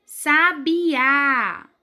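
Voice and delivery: Sabiá slow and drawn out, strong final á; Sabiá syllable by syllable with a long á; stress on the last syllable. Shown as a demonstration. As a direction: say Sabiá for repeating, landing hard on á. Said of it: "Eu vou falar e vocês podem repetir".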